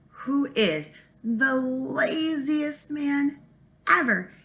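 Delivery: drawn-out emphasis. A narrator's reading. Teacher stresses lazy Jamie.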